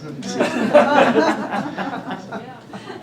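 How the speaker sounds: long high cackle of delight; high laugh. Real, not acted. Speaker cracking up at the comic, high laugh. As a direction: laugh hard at the gag.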